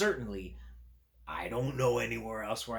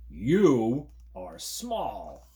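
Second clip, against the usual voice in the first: slow, different creature, louder; 2 semitones higher, 8 dB louder, much slower than usual. Acted. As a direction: drawl it out as the other fuzzy creature. Other creature answers.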